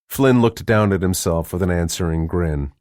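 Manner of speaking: usual speaking voice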